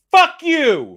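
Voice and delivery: very high, loud comic yell; mock furious shout. Co-host voices brand's hostile first tweet, mock furious shout. Acts tweet opening the door aggressively.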